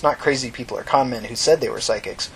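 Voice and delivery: ordinary delivery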